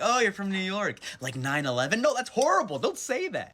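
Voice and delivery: sarcastic clueless New York greeting; mock clueless tourist. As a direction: play the ignorant stranger, then catch yourself. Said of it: "I'm like".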